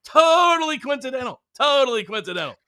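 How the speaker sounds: high, stretched-out mock conviction; sarcastic. Real, not acted. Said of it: "Hashtag sarcasm".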